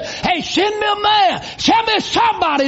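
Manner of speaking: a high shouted taunt on the first words, then back near normal; giant's shout. Retold or imitated. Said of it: "that Philistine giant would say".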